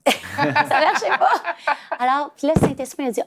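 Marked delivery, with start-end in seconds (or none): 0.0-1.3 laughing. Real, not acted. Mother laughs telling how coaching her son failed, slowly. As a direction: let the laugh carry the words. Laughing about son's reaction.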